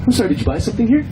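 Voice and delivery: high, sugary mock politeness; high fake-sweet campus staff voice. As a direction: imitate a condescending smile. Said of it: "They said".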